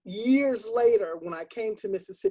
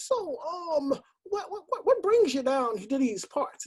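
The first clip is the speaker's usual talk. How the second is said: higher, a lightly voiced old Southern man; elderly man; 7 semitones higher than usual. Old man chatting with new renter, friendly. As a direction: ask it like a folksy old-timer. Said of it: "he was an elderly man".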